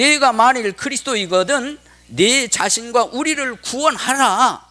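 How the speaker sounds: high-pitched mild taunt; reviling criminal. Acted. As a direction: lightly act out a jeering challenge. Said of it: "예수님 앞에서 욕을 하면서 하는 말이". (높은 목소리로 욕하듯 가볍게 흉내)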